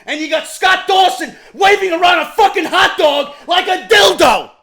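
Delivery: loud, high angry yelling; furious shout. Real, not acted. Fan yelling at WWE bookers about their roster. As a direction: blast it at the bosses.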